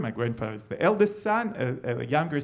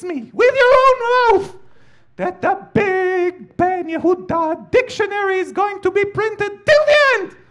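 put-on shrill, very high, loud old woman's commanding shriek; terrifying old grandma; 11 dB louder than usual. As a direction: play the dying matriarch barking orders, voice pitched way up. Dying grandma commands prime minister.

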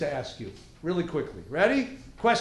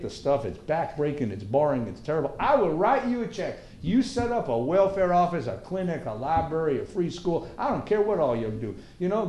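put-on Texan farmer accent; 2 semitones lower than usual. Farmer offering a check for the poor, folksy Texan accent. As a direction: make a plain-spoken Texan sales pitch. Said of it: "if you're gonna talk with that accent".

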